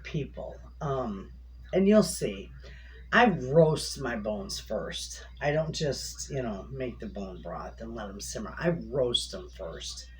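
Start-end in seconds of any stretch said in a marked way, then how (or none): 3.1-10.1 s sounds whistle through teeth; whistling s. Real, not acted. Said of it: "I'm whistling through my teeth".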